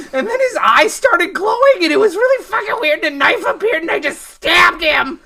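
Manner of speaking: high, loud, breathless panic ramble; frantic confession. Acted. Elaine bursts in confessing she stabbed the mailman, frantic. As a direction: spill it all out in a panic.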